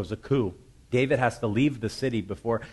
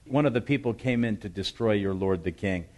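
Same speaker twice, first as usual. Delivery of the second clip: usual speaking voice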